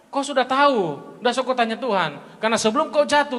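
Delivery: usual speaking voice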